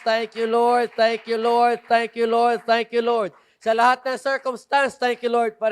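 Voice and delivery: loud, high praise chant; repeated loud thanksgiving; fervent preaching. Acted. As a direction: shout thanks to the Lord. Preaching at full force.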